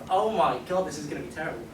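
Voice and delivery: ordinary delivery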